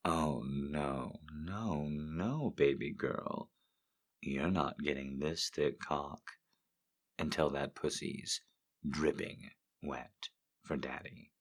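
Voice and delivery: low, soft sultry read; seductive quote reading. Acted. Streamer reading erotic quotes in a sultry voice. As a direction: purr the lines to a lover. Reads smutty quotes aloud.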